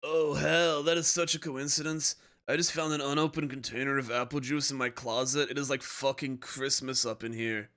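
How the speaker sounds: laid-back lightly voiced surfer dude; American surfer voice. Shown as a demonstration. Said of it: "what's my American Surfer voice".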